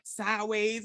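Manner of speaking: ordinary delivery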